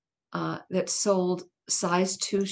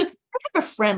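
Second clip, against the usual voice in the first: ordinary talk, nothing marked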